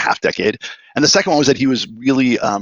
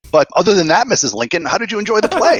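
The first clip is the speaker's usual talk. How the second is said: laughing punchline; 9 semitones higher than usual. Retold or imitated. Joke punchline, Mrs Lincoln.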